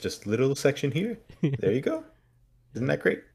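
high, lilting show-and-tell voice; playfully imitating a twee how-to presenter; mock demonstrator. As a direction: present each step in a put-on high lilt. Mocks her staged folding reveal.

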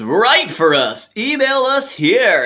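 drawn-out, high-pitched mock sales pitch; mock website pitch. Host reads imagined recruitment link, hammy slow pitch. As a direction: stretch it out like a hammy advert.

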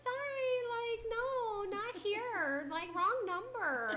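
high, dismissive imitation with a giggle at the end; imitating fake secretary. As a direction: brush the caller off in a put-on secretary voice. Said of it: "And you did, like".

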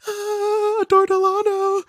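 very high, starstruck squeal of the name; little sister squealing over a drag star, giddy. Retold or imitated. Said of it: "all giddy, just like".

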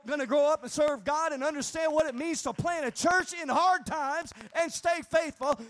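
rising faith. Retold or imitated. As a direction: declare it back, growing stronger. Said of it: "And I said".

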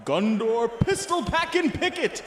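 hyped fight announcer intro. Acted. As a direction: sell the next fighter to the crowd. Commentator introduces the next fighter.

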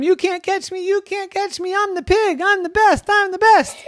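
very high sing-song teasing; taunting piglet. Acted. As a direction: gloat at everyone like a giddy pig. Pig taunting while running.

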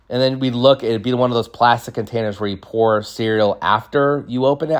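ordinary talk, nothing marked

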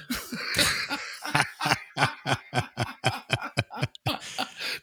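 long, high-pitched cackling fit; breathy, wheezing high laugh; helpless laughter. Real, not acted. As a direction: crack up at your own story. Cracks up at own story.

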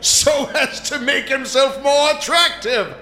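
booming theatrical god king; hammy stage actor. Acted. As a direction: ham it up as a Norse god. Plays Odin.

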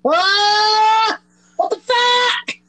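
shocked high squawk; high shocked yelp; startled. Real, not acted. Startled outburst.

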